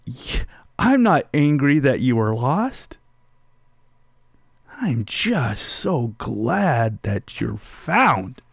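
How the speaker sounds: relieved father soothing his lost daughter; tender reassurance. Retold or imitated. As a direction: tell her you're just glad she's safe.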